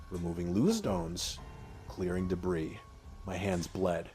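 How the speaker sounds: usual speaking voice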